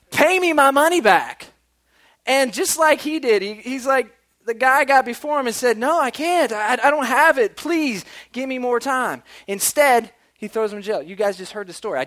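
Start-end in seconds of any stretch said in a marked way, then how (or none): 0.1-1.5 choking demand. Acted. Servant chokeholds his debtor, shouted demand. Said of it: "chokeholds this guy, and says". 5.8-9.2 loud, high-pitched begging; acted pleading. Choked debtor begs for more time, desperate pleading.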